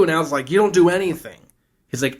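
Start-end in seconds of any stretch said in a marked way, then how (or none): 0.5-1.4 acted urgent order. Al orders panicked Gushy to stop.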